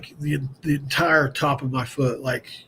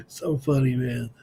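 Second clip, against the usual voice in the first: ordinary talk, nothing marked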